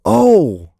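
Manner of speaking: loud, high "oh!"; big exclamation of remembering; sudden realisation. Real, not acted. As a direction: burst out as an idea strikes.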